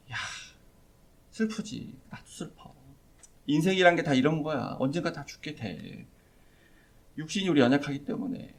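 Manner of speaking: soft, subdued words of comfort with long pauses; passive consoling. Acted. Imagined Jesus to grieving Martha and Mary, passively consoling. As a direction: sigh out a resigned, hushed consolation. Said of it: "소극적으로 위로하시는 그런 모습".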